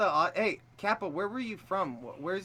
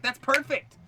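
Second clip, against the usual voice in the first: high, loud burst of approval; hammed-up self-congratulation; mock delight; 11 semitones higher, 6 dB louder than usual. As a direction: exclaim it in pretend triumph. Retelling delight at own practice.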